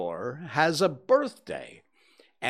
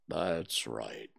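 very low, slow and mournful Eeyore; gloomy donkey; 14 semitones lower, 7 dB quieter than usual. A narrator's reading. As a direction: drag it out like a sad old donkey. Eeyore speaks.

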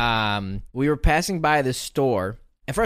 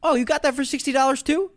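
put-on girl on boat; 12 semitones higher than usual. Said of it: "she goes".

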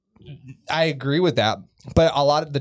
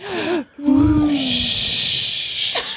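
long whoosh; 11 semitones higher than usual. Shown as a demonstration. Everybody doing it together, long soothing whoosh. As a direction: let out a long rushing whoosh. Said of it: "Let's all do it together".

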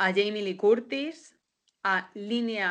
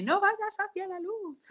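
put-on high girl voice; 12 semitones higher than usual. Quoting the ghost-film girl.

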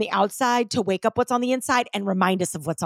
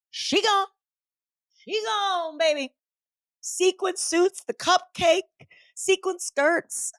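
high, drawn-out comic wail; dramatic mock farewell; 11 semitones higher, much slower than usual. Bidding her cleared-out closet goodbye, dramatic mock farewell. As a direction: stretch each item out in a high mock send-off.

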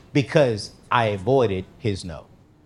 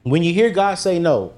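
usual speaking voice

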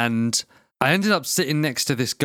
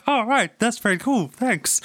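Kermit voice; 8 semitones higher than usual. Retold or imitated. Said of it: "And he's like".

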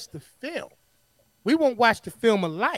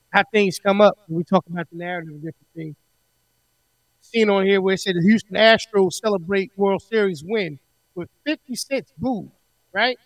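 muffled audio; 5 dB louder than usual. Real, not acted. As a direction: sound muffled, bass only. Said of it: "Why you sound muffled like that now?".

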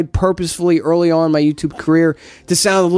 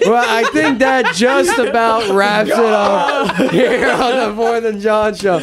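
slow, higher, laughing through the words; laughing sign-off; 4 semitones higher, slower than usual. Real, not acted. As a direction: try to close the show while cracking up. Signing off while cracking up.

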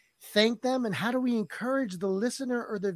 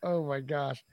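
laughing; 3 dB louder, slower than usual. Real, not acted. Laughing in disbelief.

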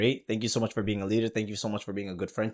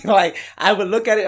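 laughing; 10 semitones higher, 10 dB louder than usual. Real, not acted. Host laughing about billboard.

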